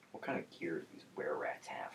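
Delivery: whispered side question. Real, not acted. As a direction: whisper it off mic. GM asks player aside, whispered.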